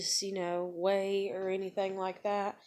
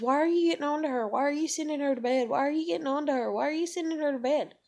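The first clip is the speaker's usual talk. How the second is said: mimicking a nagging grandmother, fast and higher; 7 semitones higher, 4 dB louder, faster than usual. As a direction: rattle off the same question over and over like a pestering grandma. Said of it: "my grandmother asked me, like, five damn times".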